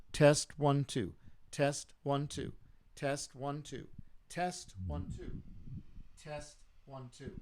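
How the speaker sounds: faint, off-mic test count; mic drifting away. Shown as a demonstration. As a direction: voice fading further from the microphone. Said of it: "moving this just a little bit away from my mouth".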